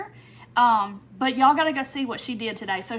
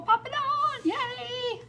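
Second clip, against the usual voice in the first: excited; 11 semitones higher, much slower than usual. Real, not acted. Greeting live viewers joining.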